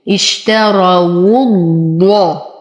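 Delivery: اشتروا الضاء with a doubled, heavy ض; slow tajweed demo. Shown as a demonstration. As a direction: glide into the doubled heavy ض slowly. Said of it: "إلى تشديد الضاد مع التفخيم". (تشديد الضاد المفخمة ببطء)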